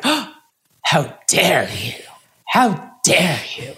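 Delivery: slow, offended how dare you, hammed-up; mock indignation. Telling her coach off in a mock-offended voice. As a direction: play being affronted, drawn out.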